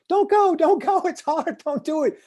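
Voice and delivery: high, loud pleading mock warning. Other souls begging you not to leap into life, mock pleading. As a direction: beg them not to go, hammed up.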